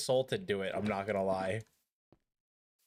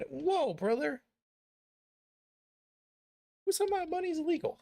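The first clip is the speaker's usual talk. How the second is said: much higher squeaky joke voice; mock high outburst. Streamer goofing to chat before a break. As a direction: squeak it out as a bit.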